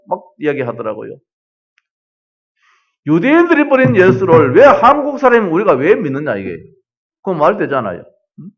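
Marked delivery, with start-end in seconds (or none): 3.1-6.6 mock indignant retort, a bit louder; a touch of the railway worker's scorn; imitating an angry worker. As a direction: retell his scornful objection with some heat. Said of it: "대들어요. 욕을 해요".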